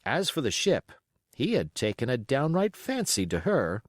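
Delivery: plain reading voice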